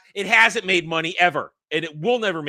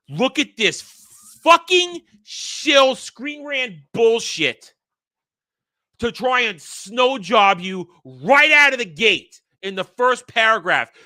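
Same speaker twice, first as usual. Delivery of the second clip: angry rant; 5 semitones higher, 7 dB louder, slower than usual. Real, not acted. Ranting at an article.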